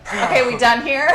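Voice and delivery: bored, cocky quip after the kill. Retold or imitated. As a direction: toss it off like a gunslinger.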